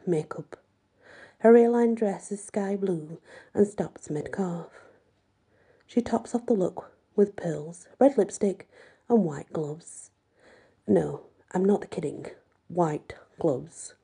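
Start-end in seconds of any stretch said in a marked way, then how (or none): none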